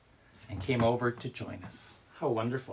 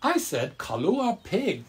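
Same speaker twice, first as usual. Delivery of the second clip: much higher, loud no, then slow correction; exclaiming grandma; 7 semitones higher, 6 dB louder, slower than usual. A narrator's reading. Grandma corrects grandpa's mix-up, high and slow. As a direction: cry out, then slowly set him right. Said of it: "Grandma exclaimed".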